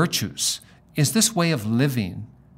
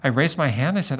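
normal talking voice

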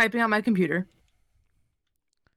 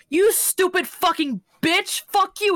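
a slow, higher mock snarl of an angry caller; mock furious customer; 8 semitones higher, slower than usual. An angry caller insulting the clerk, in a slow mock snarl. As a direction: play the rude caller cursing her out, slowed down. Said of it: "And he goes".

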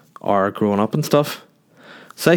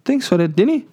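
put-on much higher child voice, spooked; frightened kid; 14 semitones higher than usual. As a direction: squeak like a scared little kid.

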